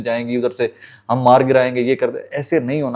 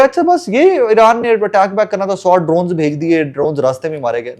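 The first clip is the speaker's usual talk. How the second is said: mock dismissive quote; 7 semitones higher than usual. Commentator mimics those dismissing Iran's drone attack, mock dismissive. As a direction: imitate naive commentators, pitched up then settling.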